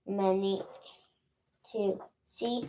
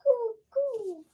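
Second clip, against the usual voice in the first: high cooing pigeon; 14 semitones higher than usual. Pretend. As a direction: coo like a pigeon.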